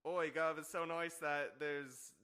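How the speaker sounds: loud, high mock English accent. Imitating Coldplay's singer to a stadium crowd, loud mock English accent. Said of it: "this is my English accent".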